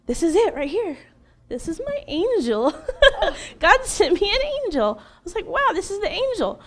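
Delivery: high-pitched, lightly acted wonder; retold wide-eyed amazement, voice lifting high; awed realization. Woman retelling the moment she found her angel, awed.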